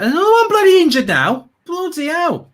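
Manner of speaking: loud, high-pitched burst of exasperation; exasperated outburst. Acted. Fan moaning about another injured player, exasperated outburst. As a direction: let it rip, voice leaping up at the bad news.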